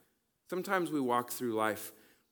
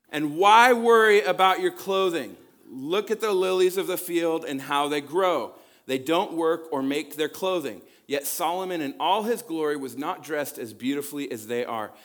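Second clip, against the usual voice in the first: much louder and higher, a full voice; preaching scripture; 4 semitones higher, 10 dB louder than usual. Acted. Pastor reads the lilies verse to the congregation, preaching. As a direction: call it out over the room.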